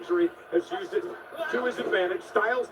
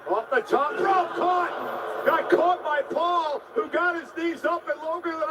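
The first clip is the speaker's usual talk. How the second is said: excited shout; 6 semitones higher than usual. Real, not acted. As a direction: shout the catch as it happens. Live call of a counter.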